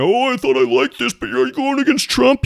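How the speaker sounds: high, whiny mock voice of a fan. Mocking an upset viewer in a high whiny voice. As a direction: squeak it out like a hurt follower. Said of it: "Somebody's getting mad right now".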